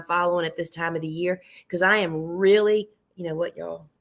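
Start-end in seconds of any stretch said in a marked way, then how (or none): none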